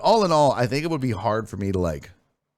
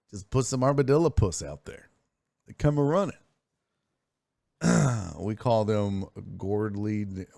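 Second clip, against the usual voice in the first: ordinary talk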